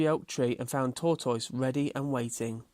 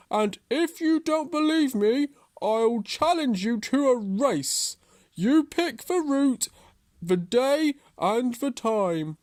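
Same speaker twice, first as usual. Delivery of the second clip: loud, high, slow challenge; cross tortoise; 11 semitones higher, 6 dB louder than usual. A narrator's reading. Tortoise challenges Hare to a race, cross, loud and slow. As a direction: throw down the challenge, each phrase deliberate. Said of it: "was cross".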